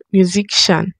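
musician pronounced "myu-zik-shan"; musician with k sound. Shown as a demonstration. Creator tries out the word, musician as "myu-zik-shan". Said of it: "Is it musician or musician?".

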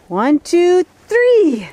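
high, rising count to go; excited countdown. Real, not acted. Counting the dog into a jump, excited. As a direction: count up with pitch climbing on each number.

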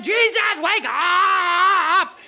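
loud, slow crying yell; desperate shout. Acted. Disciples shaking Jesus awake, desperate shout. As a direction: shout to wake him in panic.

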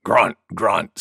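low, gruntlike words; boar's grunting. A narrator's reading. Wild Boar joining in, low grunting. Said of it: "said Wild Boar".

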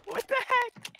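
shocked, squeaky high pitch; high surprised squeal. Real, not acted. Shocked at losing health.

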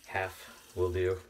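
talking through hiccups; words broken by a hiccup. Real, not acted. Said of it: "sorry, I have the hiccups, so it's hard to speak".

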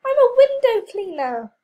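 high, loud indignant protest. Acted. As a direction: protest with outrage.

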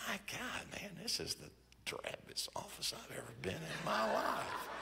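breathy near-whisper of disbelief; hushed aside. Retold or imitated. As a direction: mutter it under your breath, stunned. Inner thought on seeing the office.

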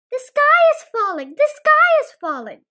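high, loud panicked squawk. Acted. Chicken Little squawking the alarm, high and loud. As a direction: shriek it in a panic. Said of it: "squawked Chicken Little".

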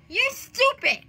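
high-pitched, strange insult; high mock taunt; odd high voice. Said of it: "Why the heck am I talking like that?".

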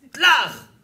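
loud, high smack noise; imitated slap. Preacher imitates the soap-opera slap, exploding loudly. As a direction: burst out a sudden slap sound effect.

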